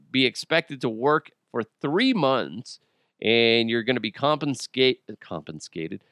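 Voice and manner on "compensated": low, much softer retry of the word; muttered correction. Real, not acted. Host fixing a slip while reading, muttered low. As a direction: mumble it under your breath.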